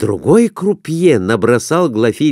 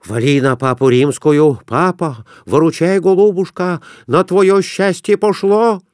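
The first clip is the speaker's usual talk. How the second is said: merchant urging luck; 7 semitones higher than usual. A narrator's reading. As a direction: coax the wheel along. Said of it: "бормотал Конурин".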